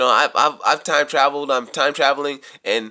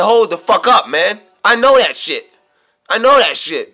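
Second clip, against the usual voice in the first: raised, louder insistence, mildly hammed; pressing the point that he already knew it; excited retelling; 3 semitones higher, 4 dB louder than usual. Recalling what he told the time traveler, excited. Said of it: "I said".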